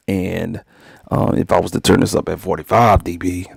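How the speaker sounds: normal talking voice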